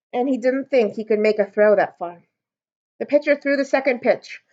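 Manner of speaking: ordinary delivery, nothing marked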